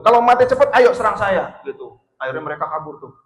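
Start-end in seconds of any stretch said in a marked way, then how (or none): 0.1-1.6 loud, higher challenge to the robbers; fierce challenge. Retold or imitated. Umar dares the three robbers to attack, loud and fierce. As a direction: dare them to attack, full voice. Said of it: "kata Sayyidina Umar gitu".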